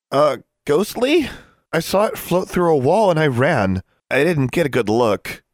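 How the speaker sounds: high, flustered and hesitant start; shaken. A narrator's reading. Boy who ran from a ghost, shaken.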